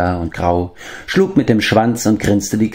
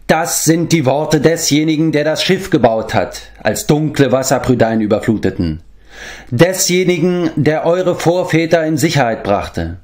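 rising, pitched higher, a grave proclamation; swelling thunderous voice; 8 semitones higher than usual. A narrator's reading. Medwin sends the animals a call to war, swelling thunderously. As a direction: let the voice swell like rolling thunder. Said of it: "seine tiefe Stimme schwoll an wie dumpfes Donnergrollen".

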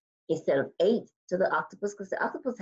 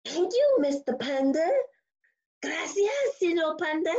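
high, gushing thanks; so excited; 10 semitones higher than usual. Acted. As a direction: squeal your thanks like a thrilled little character. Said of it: "He's, like, so excited".

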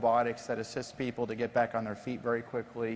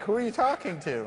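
raised, disbelieving question; baffled; 8 semitones higher than usual. Retold or imitated. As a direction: ask it in total confusion. Confused engineer retold in OR.

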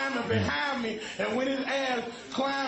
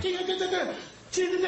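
higher, mimicking the guy's useless key turning; sing-song mock of the useless helper; mocking repetition; 6 semitones higher, much faster than usual. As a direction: parrot him turning the key over and over.

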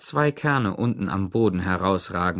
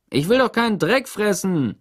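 complaining; 9 semitones higher, 4 dB louder than usual. A narrator's reading. X-Ray complains.

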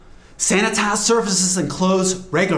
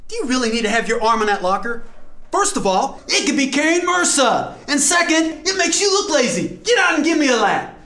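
stern coach; 8 semitones higher than usual. Acted. Coach scolding a lazy player.

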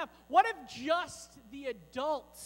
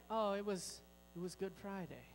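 mock sad; 5 semitones lower, 8 dB quieter than usual. Shown as a demonstration. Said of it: "maybe with a tear in our eye".

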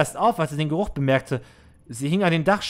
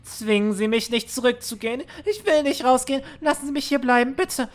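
put-on high, trembling plea of a scared teenage girl; frightened girl pleading; 8 semitones higher than usual. Scared girl begging her manager to let her stay, high and pleading. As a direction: beg not to be sent out, voice pitched way up.

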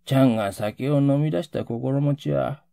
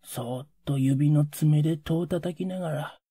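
ordinary delivery

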